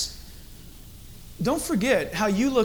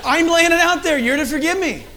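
high, raised-voice mock indignation; indignant comic retort; 7 semitones higher than usual. Father retelling apology gone wrong to teens, mock-indignant. Retold quarrel with teen daughter.

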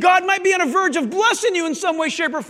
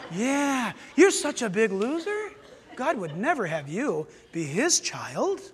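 insinuating Satan; 5 semitones lower, slower than usual. Acted. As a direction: agree slyly, then slowly rub it in. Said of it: "And Satan goes".

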